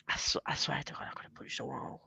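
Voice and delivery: breathy whispered aside; whispered, still fuming. Real, not acted. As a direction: hush it like a secret confession. Aside while recounting being furious.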